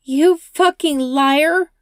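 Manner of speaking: screaming. Acted. Rachel screams at the driver, full rage. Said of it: "Rachel screams at him".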